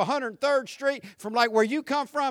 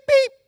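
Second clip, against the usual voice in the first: short horn sound with the voice; polite car-horn beep; mock beep; imitated horn beep; 7 dB louder than usual.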